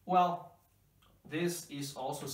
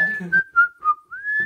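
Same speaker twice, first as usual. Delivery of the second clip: big real laugh; 15 dB louder than usual. Real, not acted. Joke about walking dad.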